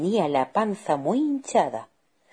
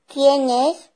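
high, loud call; high-pitched shouted question; woman's shout; 11 semitones higher, 8 dB louder than usual. Acted. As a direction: call out to whoever is at the door. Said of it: "gritó la mujer".

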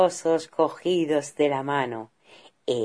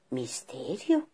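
plain reading voice

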